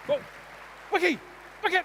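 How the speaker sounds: high, loud "oh no"; startled yell at the dog; alarmed shout. Real, not acted. Dog misbehaving on stage.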